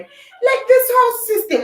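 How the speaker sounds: sudden high emphatic "Like". Real, not acted. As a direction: burst out with "Like". Exclaiming mid-rant.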